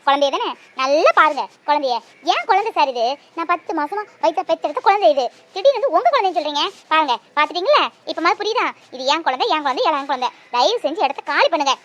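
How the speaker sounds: loud and indignant; mother loudly ordering the man out of the room; angry mother. Acted. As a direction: drive the stranger out. Mother shows baby, orders him out.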